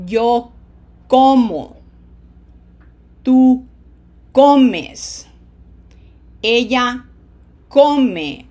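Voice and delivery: loud, drawn-out stress on "co" in "como", "comes", "come"; stressed syllables. Shown as a demonstration. Teacher has students repeat after her, stressing "co" in "como", "comes", "come". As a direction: punch the first syllable of "como", "comes", "come", pausing between words. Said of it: "you are putting pronunciation emphasis there".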